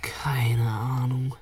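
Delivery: slow, drawn-out whisper; hushed slow shrug in a whisper. Acted. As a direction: whisper it slowly, unsure. Kids sneaking through a dark cellar.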